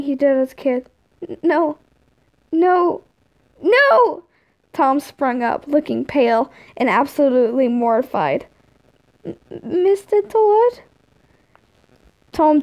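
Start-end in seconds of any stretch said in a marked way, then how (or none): 1.3-4.2 building from small no to high cry; rising, frightened sleep-talk; nightmare panic. A narrator's reading. Nightmare, Tom wakes with a start. 9.2-10.8 high, slow, stammered question; small, rising childish voice; timid kid. A narrator's reading. Tom waking frightened, calls Tord.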